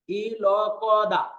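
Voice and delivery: slow, separated syllables for memorizing; spelled out. Shown as a demonstration. Teacher has students memorize the spelling, spelled out slowly. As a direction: drill each sound on its own. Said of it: "এভাবে বানানটা মুখস্থ করবেন".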